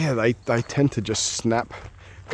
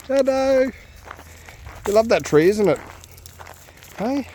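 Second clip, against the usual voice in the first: much higher, sing-song pet voice; 10 semitones higher than usual. Real, not acted. Owner cooing at his dog Shadow in baby talk.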